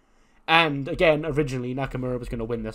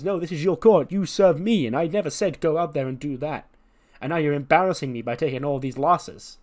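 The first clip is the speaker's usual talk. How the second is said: angry scolding; 4 semitones higher, 6 dB louder than usual. Retold or imitated. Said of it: "And Charlotte goes".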